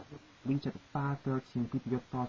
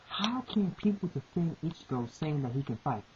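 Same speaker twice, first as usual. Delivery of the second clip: ordinary talk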